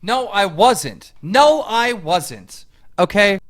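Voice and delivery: loud, slow, emphatic denial; real anger, loud and drawn out; angry denial. Real, not acted. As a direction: hammer each word, furious at the accusation. Angry denial during heated dispute.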